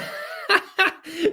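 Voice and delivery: high-pitched burst of laughter; cracking up. Real, not acted. Coach reading Jennifer's comment, cracking up. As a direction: crack up mid-sentence. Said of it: "you crack me up".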